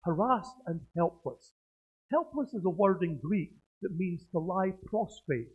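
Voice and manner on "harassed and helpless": "Harassed" with the stress on its second syllable. Shown as a demonstration. Preacher settling on a pronunciation, "Harassed" stressed on RASS. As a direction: say "Harassed" as huh-RASS'D. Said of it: "the emphasis on the syllable".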